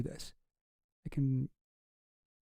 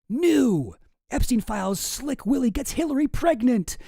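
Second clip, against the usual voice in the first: loud, high, sensational headline read; mock headline read; 6 semitones higher, 4 dB louder than usual. Streamer reading a wild political headline to the audience, mock sensational. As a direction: read it like a breathless tabloid alert.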